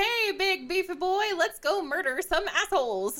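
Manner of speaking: half-joking battle cry; eager mock command. Pretend bold order to the knight.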